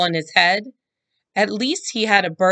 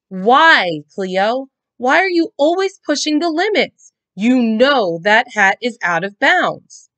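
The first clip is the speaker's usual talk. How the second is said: high, loud exasperated scolding; angry mother scolding; 7 semitones higher, 6 dB louder than usual. A narrator's reading. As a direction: demand an answer from her. Mom scolding her daughter.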